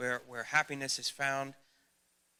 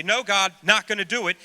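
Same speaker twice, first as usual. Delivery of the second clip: loud, higher defiant cry; defiant refusal; 6 semitones higher, 16 dB louder than usual. Retold or imitated. Preacher replays refusing God, loud stubborn defiance. Defying God in retold dialogue.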